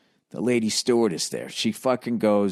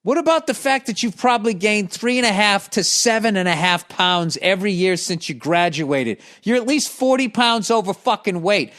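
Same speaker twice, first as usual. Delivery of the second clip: imagined telling-off; 8 semitones higher than usual. Pretend. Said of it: "I so wanted to be like".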